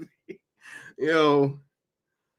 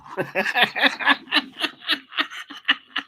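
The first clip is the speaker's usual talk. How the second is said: real helpless high-pitched laughter; cracking up at chat, high and breathless; long high laugh; 13 semitones higher than usual. Real, not acted. Streamer to chat, bursting into high laughter.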